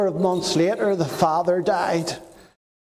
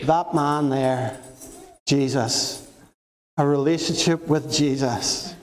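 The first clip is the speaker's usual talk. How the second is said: sincere and hushed; 5 semitones lower than usual. Real, not acted. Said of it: "preacher lowers voice for Jesus".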